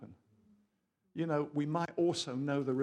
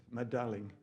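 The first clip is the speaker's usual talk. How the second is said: light French accent; lightly put-on French lover's accent; 4 semitones lower than usual. Shown as a demonstration. Preacher starting a romantic Bible verse, French accent. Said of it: "do it with a French accent".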